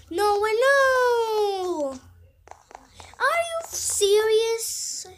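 high, loud, slow drawn-out protest; stretched, loud no way; outraged disbelief. Real, not acted. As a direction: cry out in real disbelief. Players join then leave.

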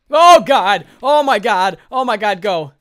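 loud, high frightened cries; panicked cries. Real, not acted. Gamer hiding from zombies, crying out in fright. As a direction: cry out in fright.

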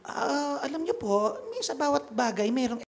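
a priest melting at the mention of money; priest suddenly yielding. Retold or imitated. Priest to the rich woman, suddenly agreeable and high-pitched. Priest tempted by half-million donation.

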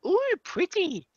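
ordinary talk, nothing marked

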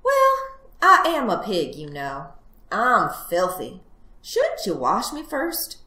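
high opening, lilting suggestion; cunning little piglet making excuses; wheedling piglet. Acted. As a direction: coax the fox with feigned innocence. Plays the piglet.

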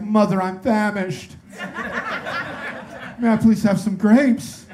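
put-on high, slow, pleading little kid; mock desperate child pleading for grapes; desperate polite child. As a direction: beg like a starving well-mannered child. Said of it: "a hint of desperation in their voice".

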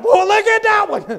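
high, loud burst of wonder; excited kid. Acted. Preacher as a kid spotting a puddle, excited.

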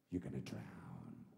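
very low, slow trailer voice; menacing movie-trailer narrator; deep voiceover voice. Retold or imitated. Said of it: "in a deep voiceover voice".

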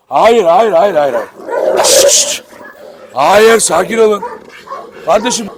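loud firm no at fighting dogs; stern shouting. Real, not acted. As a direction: shout them apart. Yine kavgayı ayırıyor. (kavgacı köpeklere sert ve yüksek sesle bağırıyor)

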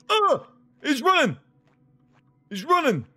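high, loud alarm as he runs; startled high cry. Real, not acted. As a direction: yelp in panic as he bolts. Startled by chasing character.

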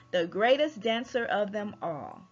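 ordinary delivery

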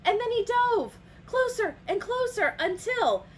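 high, loud, urgent narration; building suspense. A narrator's reading. Storyteller follows Toucan diving at fireflies, loud excited suspense. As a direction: race toward the big moment.

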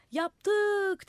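high, loud, stretched call; crowd shout. A narrator's reading. Crowd giving in to the madman, shouting. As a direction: holler it up to the roof. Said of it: "diye bağırdılar".

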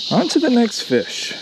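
lightly imitating a fishing YouTuber; a hint of his catchphrase delivery; Marling Bates. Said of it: "I swear I'm not copying Marling Bates".

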